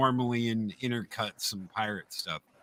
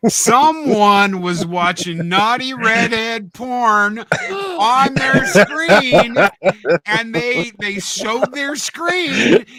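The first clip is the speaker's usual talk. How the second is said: laughing; 9 semitones higher, 7 dB louder, slower than usual. Real, not acted. Laughing story of embarrassing tab.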